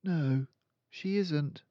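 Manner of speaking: put-on lifted high, slow girl's voice; a calm, certain young girl; Matilda's voice. Matilda correcting her friend, in a high girl's voice.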